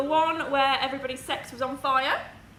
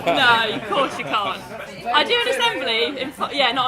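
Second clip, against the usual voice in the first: projecting voice; 7 dB louder than usual. Real, not acted. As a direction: speak up without a mic. No microphone, speaks up.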